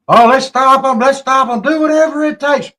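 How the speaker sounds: high, loud mocking falsetto; mocking politician. Imitating two-faced politicians, a high, loud mocking voice. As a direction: ham up a phony politician rallying the crowd.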